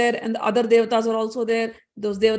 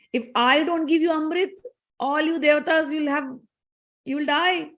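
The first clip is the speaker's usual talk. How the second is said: lightly higher scolding; scornful rebuke; 5 semitones higher than usual. Acted. Moon god scolding Indra and Agni, lightly scornful. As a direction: put both gods in their place.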